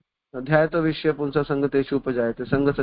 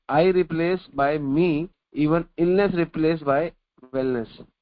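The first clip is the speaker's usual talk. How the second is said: slow, deliberate recitation of the teacher's words; slow quoted teaching; much slower than usual. Retold or imitated. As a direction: quote the acharya word by word. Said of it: "हमारे आचार्य बताते हैं".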